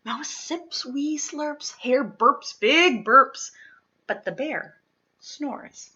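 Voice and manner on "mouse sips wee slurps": ordinary delivery, nothing marked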